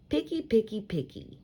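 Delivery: sing-song grown-up nagging on "Picky, picky"; mock nagging. Narrator mimicking grown-ups nagging, mock nag. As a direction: tease the word like a fussy adult.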